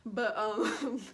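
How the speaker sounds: normal talking voice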